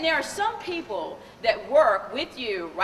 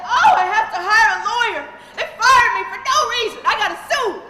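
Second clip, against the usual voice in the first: higher, louder, half-laughing panic; imitating a distraught client; 8 semitones higher, 8 dB louder than usual. Client calling the lawyer in a panic, hammed-up distress. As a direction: play her frantic phone call with a grin. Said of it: "crying hysterically".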